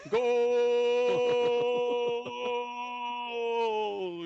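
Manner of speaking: long, high, loud stretched "Goal!"; European sports broadcaster. Retold or imitated. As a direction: hold the goal call like a football commentator. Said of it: "like a European sports broadcaster".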